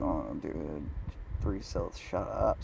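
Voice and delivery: low, very soft grumble to oneself; muttered self-reproach. Retold or imitated. Man chiding himself for talking too much, muttered self-reproach. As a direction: scold yourself under your breath. Scolding himself after her complaint.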